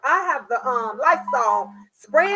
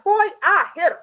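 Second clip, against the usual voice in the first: loud, higher scold; 5 semitones higher, 6 dB louder than usual. Retold or imitated. Mother scolding her son in the car. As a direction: bark at him. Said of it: "I'm like".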